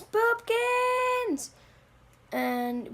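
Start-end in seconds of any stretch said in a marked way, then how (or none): 0.0-1.5 very high, long cartoon cry; drawn-out yell. Crazy Apple runs to the ledge, high drawn-out yell. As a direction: call desperately after someone falling, hammed-up. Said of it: "runs to the ledge and yells".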